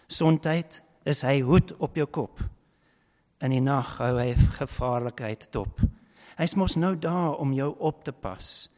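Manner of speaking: Afrikaans psalm read in a Griqua accent. Shown as a demonstration. Preacher reading a Griqua psalm to the congregation, in a Griqua accent. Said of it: "to read it in a Griqua accent".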